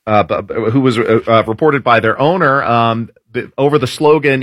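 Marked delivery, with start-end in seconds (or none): none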